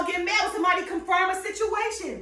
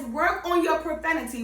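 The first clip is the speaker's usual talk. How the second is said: ordinary talk, nothing marked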